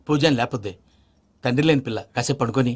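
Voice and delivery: low, soft murmur; hushed. Acted. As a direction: keep it down so the child sleeps. Lets sleeping child rest.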